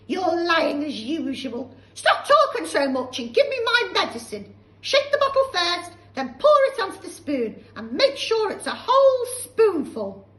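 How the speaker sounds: put-on shrill, loud scolding old woman; cranky old grandma. Grandma scolding George, loud and high. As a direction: snap at the boy like a nasty old hag.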